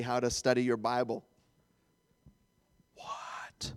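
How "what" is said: hushed, whispered disbelief; mock shocked whisper. Preacher plays churchgoer hearing of Bible class, shocked whisper. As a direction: whisper it as an affronted objector.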